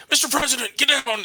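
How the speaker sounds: high, urgent mock alarm; high-pitched cry of pretend danger; bodyguard's warning. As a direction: play an agent warning the president. Acts out bodyguard protecting president.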